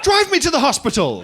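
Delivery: rude stranger. Retold or imitated. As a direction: scold him out of nowhere. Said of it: "hone in on you and go".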